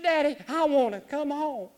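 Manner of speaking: put-on high, pleading little-girl voice; daughter pleading.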